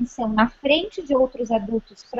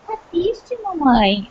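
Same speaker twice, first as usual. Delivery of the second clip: much higher, lightly voiced child asking; imitating little daughter; 5 semitones higher, slower than usual. Daughter asking crying mom if she's sad, in a high childish voice. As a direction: sound like a toddler asking mommy. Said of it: "Ela fala".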